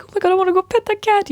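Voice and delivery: high, fast, gushing squeal; gushing cat lover. Retold or imitated. Cat lover spotting a cat, high excited squeal.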